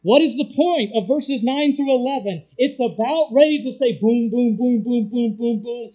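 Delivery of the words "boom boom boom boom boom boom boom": drum roll boom. Shown as a demonstration. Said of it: "It's a drum roll".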